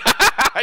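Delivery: short laugh. Real, not acted. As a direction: burst into a brief laugh. Laughs at own acted quote.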